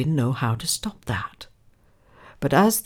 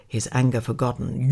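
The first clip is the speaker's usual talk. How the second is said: plain reading voice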